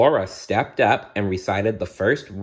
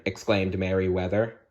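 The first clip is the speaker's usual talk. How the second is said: plain reading voice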